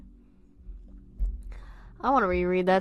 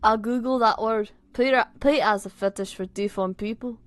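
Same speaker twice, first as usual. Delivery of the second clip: put-on Scouse accent, freshly reset; 5 dB louder than usual. Reader resuming the story, Liverpool accent. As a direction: launch back into a Liverpool accent. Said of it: "I have to say".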